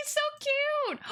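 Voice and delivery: put-on very high, slow baby-talk coo; cutesy cooing. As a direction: coo over it like a baby picture. Cooing over snake picture.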